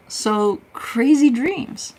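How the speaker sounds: high, slow sing-song; a mock-spooky sing-song. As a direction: stretch it out in a high playful tone. Sing-song high drawn remark.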